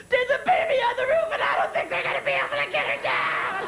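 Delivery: high, hammed-up frantic shout; panicked yell. Viewer phoning sister about TV rescue, panicked yell. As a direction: scream the emergency down the phone in mock panic.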